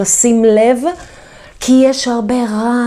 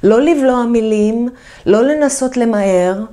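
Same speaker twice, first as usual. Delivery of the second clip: ordinary talk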